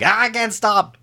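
high, loud comic cry of alarm; mock panicked yell. Skater jokes about himself careening out of control, mock panicked yell. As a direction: yelp it like a hammed-up runaway skater. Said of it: "me when I'm like".

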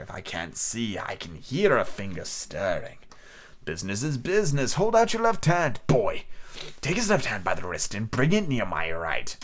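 soft, slow, menacing beggar; cruel cold voice. A narrator's reading. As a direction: whisper the threat coldly. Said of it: "a voice so cruel and cold and ugly".

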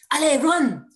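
loud French takeoff call; shouted command. Retold or imitated. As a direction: yell at her to run. Instructor calling takeoff.

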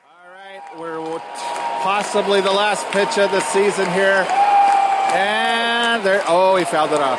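slowed, excited game call; live play-by-play. Real, not acted. Host calling the ballgame for the audience, live play-by-play. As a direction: call the last pitch as it happens.

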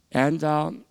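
usual speaking voice

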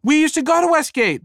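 chirpy wife. Retold or imitated. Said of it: "the woman said".

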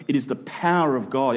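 ordinary talk

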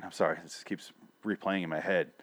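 ordinary delivery

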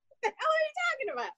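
fast, high and incredulous; exasperated disbelief. Retold or imitated. Answering friends who call them lucky, incredulous and fast. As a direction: rattle it off in sheer disbelief.

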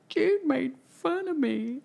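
put-on high, slow little-kid whimper; crying child. Crying six-year-old tells parent about his brother, imitated tearful child voice. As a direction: imitate a hurt small child tattling. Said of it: "can you tell me why you're crying".

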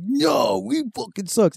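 high, whiny protest; mock drunken wail. Drunk brothers told to stop, high mock-tearful protest. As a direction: wail it like a drunk near tears. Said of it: "one of them started crying".

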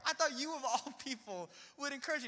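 high, loud complaint with a laugh; incredulous protest. Retold or imitated. To a relative who questioned him, incredulous protest. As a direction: retell an indignant protest, half laughing.

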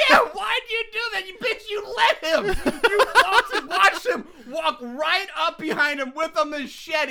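high, laughing rant at the character; laughing mock scold. Real, not acted. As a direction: tell her off through laughter.

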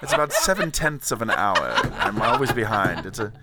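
plummy English gentleman Tony, hammed-up and pleased with himself; campy British toff accent, laughing through the lines; posh British accent. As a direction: play a pompous Englishman who cracks himself up. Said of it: "the accent is back".

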